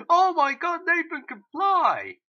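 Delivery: high, loud astonished cry, slow on the last words; shocked at the twist; amazed shout. Real, not acted. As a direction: burst out in disbelief. Reacting to Nathan flying.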